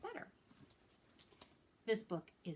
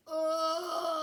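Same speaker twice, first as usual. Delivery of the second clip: loud, high hammed-up moan; sad moan; 9 semitones higher, 9 dB louder than usual. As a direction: groan like you feel awful. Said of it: "sad ... moan".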